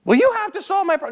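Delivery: high nagging voice cut off mid-word; whiny demand. Retold or imitated. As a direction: play the pushy person dumping a problem. Voicing the demanding other person.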